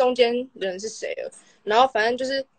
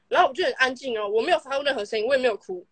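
ordinary talk

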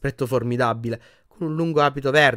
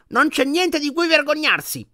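put-on much higher, slow indignant old witch; angry grandmother; 4 dB louder than usual. A narrator's reading. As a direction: flare up at the grandson. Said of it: "si adirò la signora Paciok". (la nonna si adira, voce molto acuta)

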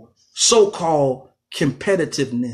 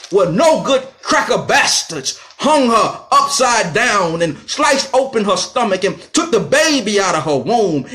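rising furious outcry, louder and higher; outraged; 6 semitones higher, 4 dB louder than usual. Real, not acted. Radio host denouncing the lynching in a loud outraged cry. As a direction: let the outrage build line by line.

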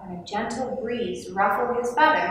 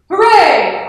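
high, loud hooray; big jubilant cheer; 9 semitones higher, 11 dB louder than usual. A narrator's reading. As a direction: cheer it out with full voice. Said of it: "Otto cheered".

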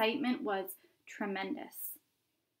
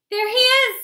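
much higher, very loud shout; 16 dB louder than usual. A narrator's reading. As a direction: yell excitedly as the gates open. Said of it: "somebody shouted".

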